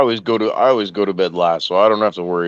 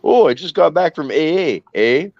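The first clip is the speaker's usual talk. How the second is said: higher-pitched mock Canadian guy; put-on Canadian cartoon voice; Canadian accent; 6 semitones higher than usual. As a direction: imitate a cartoon Canadian, lilting. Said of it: "they run into the Canadian guy".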